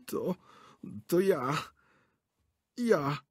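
high, stammering, shaky reply; trembling voice. A narrator's reading. Director caught under the sofa, trembling stammer. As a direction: stutter it out caught and scared. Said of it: "drżący głos".

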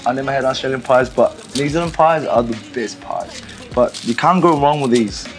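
ordinary talk, nothing marked

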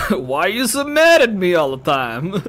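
put-on loud, high drunken whine; whiny drunk girl. Drunk girl to her angry friend, loud whine. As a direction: wail it like a wounded drunk.

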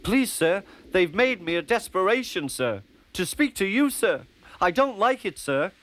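high, anxious small-engine voice; Percy pleading nervously to the Fat Controller, high and anxious; nervous little engine. Acted. Percy's voice, held.

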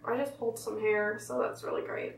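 talking through tight mask; peeling face mask off. Real, not acted. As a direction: fingers pulling at chin. Seen 1: left speaker picks dried mask on chin beside mouth throughout.

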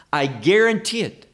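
ordinary talk, nothing marked

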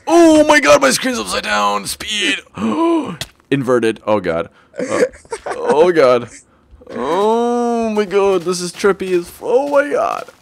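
high, frantic yelps; freaked out. Real, not acted. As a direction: squeal in wild disbelief. Screen flips, tripping in game.

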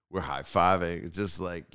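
usual speaking voice

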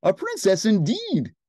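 high, loud, disbelieving queen; loud royal indignation; haughty queen. A narrator's reading. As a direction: scoff it like a storybook queen. Queen doubts the girl.

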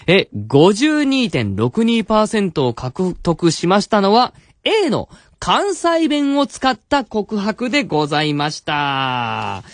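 slow, high announcement, drawn out to the end; announcer result reveal. Acted. Host reveals the poll winner to listeners, announcer style.

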